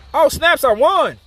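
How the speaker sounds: high exclamation of real surprise; surprised win. Real, not acted. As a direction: burst out at an unexpected victory. Player realises he just won.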